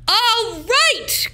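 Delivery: loud, high mayor exclamation; mayor cries out. A narrator's reading. Mayor gives in to Sophia, crying out loudly. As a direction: declare it with a burst. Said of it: "cried the mayor".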